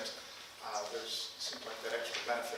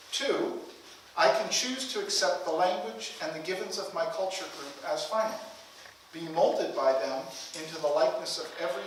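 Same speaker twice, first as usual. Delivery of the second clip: higher, measured literary reading with pauses; formal reading aloud; 3 semitones higher than usual. Acted. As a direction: set out each point as if from a lectern. Reads a book passage aloud.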